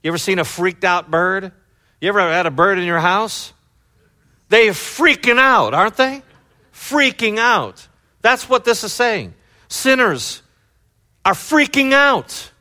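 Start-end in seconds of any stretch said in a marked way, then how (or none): 4.5-12.5 high, loud, drawn-out exclamation; freaking out. Acted. Preacher likens sinners to panicked birds, freaking out. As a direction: cry it out high, stretching the words.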